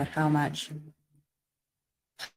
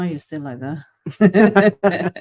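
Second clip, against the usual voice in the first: laughing through the words, slow and louder; 3 semitones higher, 8 dB louder, much slower than usual. Real, not acted. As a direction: tease them while laughing. Teasing friend while laughing.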